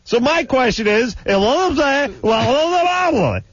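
high, worked-up gibberish complaint; mock angry babble. Shown as a demonstration. Host acts out his rant as co-host hears it, high mock-angry babble. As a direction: parody your own angry rant at a squealing pitch. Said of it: "you hear me getting angry".

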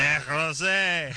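slow, high "Desrosiers" with a throaty fake French r; mock French accent. Host aping the guest's French pronunciation. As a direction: copy the French r in "Desrosiers", hammed up. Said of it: "these guys are French Canadian".

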